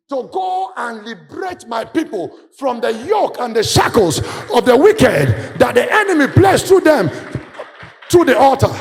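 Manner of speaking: loud, high preaching cry; preaching shout. Acted. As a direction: build to a roaring shout. Preaching climax, God's commission.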